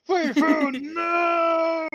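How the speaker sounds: laughing, hammed-up cry of loss; mock wail. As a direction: wail it like a hammy tragedy. Mock despair at fleeing animals.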